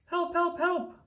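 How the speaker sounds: very high, frantic cries for help; crying for help. Acted. Stuck little truck calls out to the farm animals, high and frantic. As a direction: squeal it out like a panicked little truck. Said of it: "cried the Little Blue Truck".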